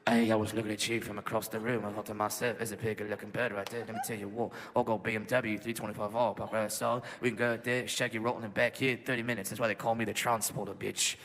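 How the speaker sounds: put-on low South London tough-guy accent; low, quiet, monotone London chat-up; Jason Statham. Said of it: "South London Jason Statham type British accent".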